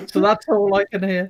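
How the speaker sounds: normal talking voice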